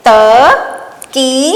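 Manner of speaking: very slow, sounds drawn apart; slow dictation. Shown as a demonstration. Teacher dictating a word to pupils, very slowly. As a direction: spell it out aloud slowly. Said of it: "तो ये बन गया हमारा श्रुतलेख".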